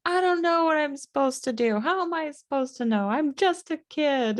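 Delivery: put-on high-pitched whiny kid voice; hammed-up child's meltdown; tearful meltdown. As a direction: wail it like an overwhelmed child. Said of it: "tears and a meltdown".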